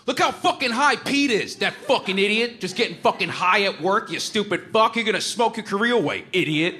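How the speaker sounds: high, hammed-up rant at a stoner; mock rant. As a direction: jeer at him in a squeaky mocking voice. Louis mocking him by the elevators.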